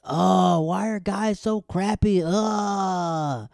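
slow, high mock-girl lament; theatrical wail in a put-on high voice; hammed-up dramatic. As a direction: overplay an exasperated girl, dragged out. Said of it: "does the dramatic".

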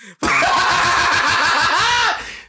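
real high shrieking laugh. Real, not acted. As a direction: burst out in a high cackle. Laugh after the begging bit.

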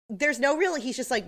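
ordinary talk, nothing marked

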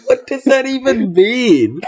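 high, laughing what does that mean; laughing disbelief. Real, not acted. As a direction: squeal it through laughter. Laughing shock at the misspelling.

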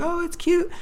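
high, cooing voice of the wife. Retold or imitated. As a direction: coo over the dog photo. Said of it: "She's like".

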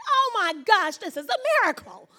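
high, loud, laughing wonder; high laughing exclamation; laughing amazement. Retold or imitated. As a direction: laugh in joyful disbelief. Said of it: "I said".

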